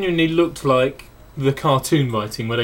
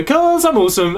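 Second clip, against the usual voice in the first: high, louder smug brag; smug boast; 11 semitones higher, 4 dB louder than usual. Retold or imitated. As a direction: brag it like a cocky goofball. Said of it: "You'd go".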